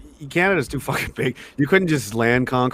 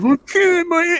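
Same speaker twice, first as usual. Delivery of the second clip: high-pitched mock whine; whining; 13 semitones higher, slower than usual. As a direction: imitate a soldier whining in protest. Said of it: "he's, like, whining".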